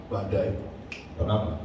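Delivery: low, firm command to the storm; calm authority. Retold or imitated. Jesus commands the storm, low calm authority. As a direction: speak it with quiet authority. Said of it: "berdiri dalam otoritas".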